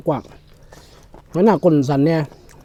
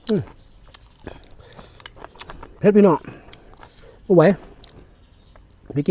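loud pained cry, higher; spicy food; 6 semitones higher, 4 dB louder than usual. Real, not acted. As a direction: cry out as the chili burns. Burning mouth from chili. (ร้องเสียงดังเพราะเผ็ดจัด)

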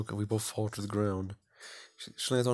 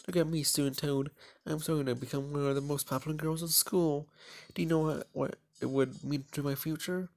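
put-on higher, proud girl bragging; proud schoolgirl; 5 semitones higher than usual. Popular girl lecturing her classmate, higher and proud. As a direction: puff yourself up as the popular girl. Isabella boasting about popularity.

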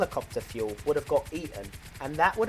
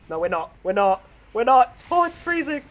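rising panic, getting louder; panicked building yell; 8 semitones higher, 9 dB louder than usual. Real, not acted. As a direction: panic harder with each repeat. Panicking as helicopter goes down.

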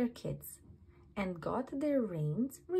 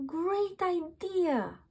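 high, slow joyful exclamation; overjoyed cry; 8 semitones higher, slower than usual. A narrator's reading. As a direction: cry it out, thrilled by the idea. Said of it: "he cried".

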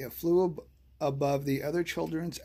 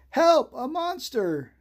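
scared cry; 10 semitones higher, 6 dB louder, slower than usual. A narrator's reading. Children flee the monster screaming.

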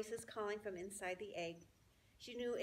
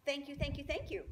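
high, eager repeated thanks; proud goose bowing; preening goose; 6 semitones higher, 4 dB louder than usual. Acted. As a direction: lap up the praise shamelessly. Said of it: "nodding and bowing shamelessly".

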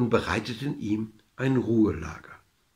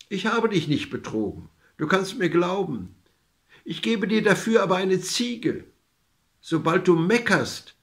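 put-on higher voice of the old woman; lightly voiced old woman making a promise; old woman reassuring; 6 semitones higher than usual. A narrator's reading. Old woman offers the man a magic goat, higher voice.

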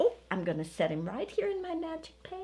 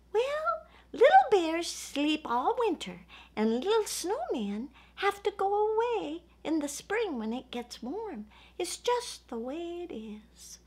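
lightly voiced snowman, higher and gentle; Sparkly the snowman; 7 semitones higher than usual. Snowman Sparkly answers Little Bear.

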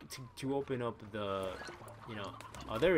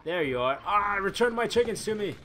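1 loud call; 6 semitones higher, 7 dB louder than usual. Real, not acted. Feeding chickens to Gordo.

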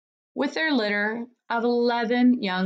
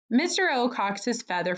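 ordinary delivery, nothing marked

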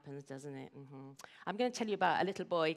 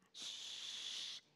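soft exhale ending in a shush; big sigh into shh; 11 dB quieter than usual. Shown as a demonstration. Storyteller shows how Simon stopped yelling, sighing shh. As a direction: breathe out long, hissing into shh. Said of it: "that big sigh thing".

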